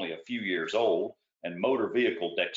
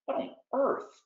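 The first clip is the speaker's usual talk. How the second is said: startled. A narrator's reading. Hears window crash.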